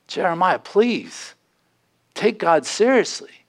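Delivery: pleading with the neighbor. Acted. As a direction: beg him to take it seriously. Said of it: "beg and plead with your neighbor".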